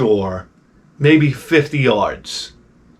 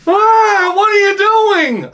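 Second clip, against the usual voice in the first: very high, slow, loud mimicry; mock screeching yell; 4 dB louder, much slower than usual. Wife scolding sinking husband, mock screeching yell. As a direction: screech it like an exasperated scolder, hammed-up. Wife yelling again as he sinks.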